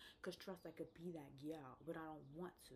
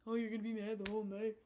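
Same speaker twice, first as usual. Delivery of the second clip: mocking his whine; 3 semitones higher, 10 dB louder, faster than usual. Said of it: "now you're mad at me".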